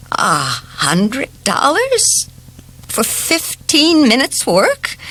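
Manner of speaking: slow, high, rising disbelief held under a quiet voice; quiet dismay. A narrator's reading. Old neighbour hears the repair bill, slow stunned disbelief. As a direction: let the shock creep up through the question. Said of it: "The quiet of Missus Bronson's voice did not cover her dismay".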